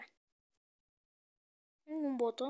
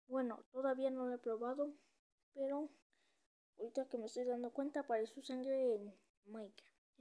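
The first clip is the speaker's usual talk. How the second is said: ordinary talk, nothing marked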